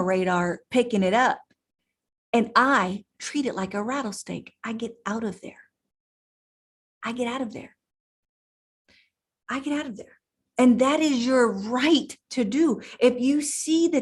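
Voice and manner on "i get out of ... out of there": quiet repetition. Real, not acted. Repeats escape line, dropping voice.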